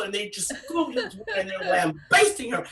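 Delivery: usual speaking voice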